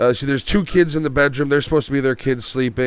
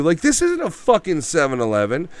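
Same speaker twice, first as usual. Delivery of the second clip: usual speaking voice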